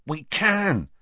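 high, eager reply; keen, hushed excitement; excited. A narrator's reading. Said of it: "said Ron excitedly".

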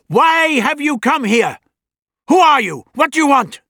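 put-on high, loud commanding old man; stern old doctor. A narrator's reading. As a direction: demand answers like a stern elder. Doctor confronts the alien.

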